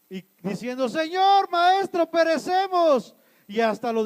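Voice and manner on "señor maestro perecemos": high, loud desperate cries; acted shouting. Disciples crying to their master in the storm, desperate shout. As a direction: shout for help, stretching the final word. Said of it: "te la pases gritando".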